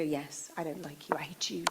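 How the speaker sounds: breathy hushed whisper of a hater; a hushed, mock-cruel whisper; whispered insult. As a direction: hiss the insult softly like a secret.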